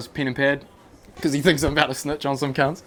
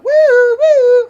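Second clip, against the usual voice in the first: high loud siren woo woo; mock alarm; 11 dB louder than usual. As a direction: imitate a bullshit radar alarm. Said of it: "she was like".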